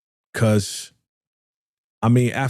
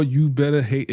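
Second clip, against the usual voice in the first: normal talking voice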